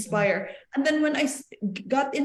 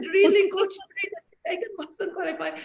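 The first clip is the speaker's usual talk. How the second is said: bursting with excitement, higher; excited gasp of hope; thrilled disbelief; 10 semitones higher than usual. Retold or imitated. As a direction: voice jumps high, then rushes. Excited reply to coach.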